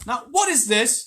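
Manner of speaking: high, loud and slow puzzled cry; boy bewildered by the mob. A narrator's reading. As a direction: call out, baffled by the stones.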